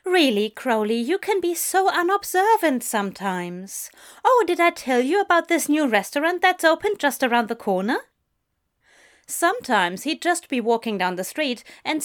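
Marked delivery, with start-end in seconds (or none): 0.0-8.0 higher-pitched chatty, fussy angel; prissy angel. A narrator's reading.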